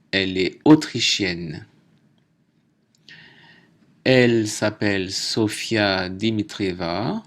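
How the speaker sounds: ordinary talk, nothing marked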